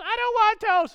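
put-on high, loud whining kid yell; mock tantrum. Kid refusing dad's toast at breakfast, mock tantrum yell. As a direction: imitate a child shouting a refusal.

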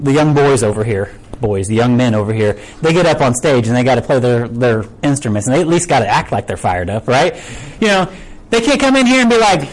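ordinary talk

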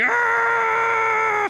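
long, very high scream. Acted. Squirrel didn't hear the voice, kept screaming. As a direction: keep screaming over everything. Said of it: "he kept on screaming".

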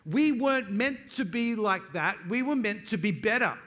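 loud, lifted declaration; preaching at full force. Acted. Pastor rousing his church to do better, full-force preaching. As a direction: proclaim it to the room.

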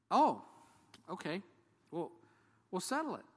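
high, bright quick agreement; eager relief. Retold or imitated. To the man unsure of his baptism, eager high relief. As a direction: jump at the chance.

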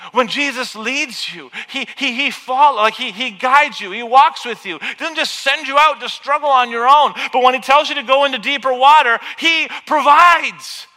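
loud, rising, impassioned preaching; full-force preaching. Acted. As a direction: drive it home to the congregation.

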